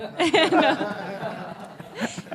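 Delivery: long burst of laughter; loud, amused laughing; real laugh. Real, not acted. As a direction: laugh out loud for a couple of seconds. Amused by teacher's remark.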